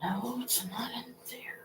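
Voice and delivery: soft panicked whisper. Real, not acted. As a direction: whisper it in quiet dismay.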